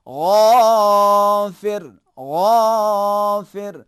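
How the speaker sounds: غافر stretched, throaty, loud; drawn-out ghain. Shown as a demonstration. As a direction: pull the غ of غافر long and hold it. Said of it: "آواز کو بھی کھینچنا ہے".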